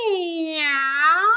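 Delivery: drawn-out rising meow; puppy meowing instead of barking; cat meow. A narrator's reading. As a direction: meow like a kitten. Puppy meows.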